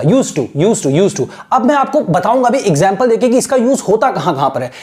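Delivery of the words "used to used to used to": used to said as "yuzto", d silent, repeated; silent d demo. Shown as a demonstration. Teacher drilling used to with the d dropped. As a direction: run used to together with no d. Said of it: "डा silent रहेगा".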